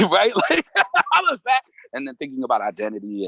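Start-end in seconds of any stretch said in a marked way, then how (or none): 0.0-1.6 high, breaking into laughter; laughing it off, much higher; giggling question. Real, not acted. Laughing about crying more.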